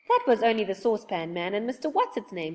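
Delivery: plain reading voice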